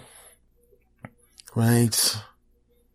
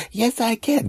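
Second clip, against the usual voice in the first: put-on little kid voice; slower than usual. Nephew answers.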